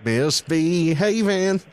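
high, slow hammed-up hillbilly mama talk; West Virginia accent. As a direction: drawl it slow and high like a country mother. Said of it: "I'll try and do the accent".

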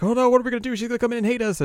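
high, mock panicky fretting; nervous panic. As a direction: fret like a scared girl. Kanan is very nervous.

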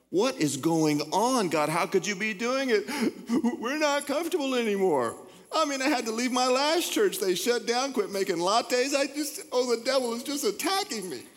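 high, whining mock complaint; whiny complaining believer. Preacher mocking comfort-loving church members, high and whining. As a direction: whine it like a spoiled churchgoer.

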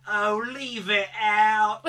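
slow, high and loud bad British accent. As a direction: ham up a terrible British accent, drawn out. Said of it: "his bad British accent".

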